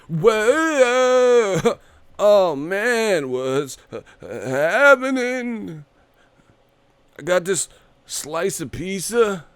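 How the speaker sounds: high, slow goofy party dork; Bob impression. Mocking uncool fans with the Bob voice. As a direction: stretch it like a dork arriving at a party. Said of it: "They like Bob from marketing".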